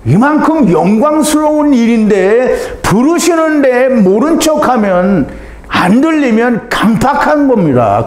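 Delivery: high, slow urgent preaching; fervent preaching. Real, not acted. Preacher warning the hard-hearted, slow urgent preaching. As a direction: press the warning home slowly.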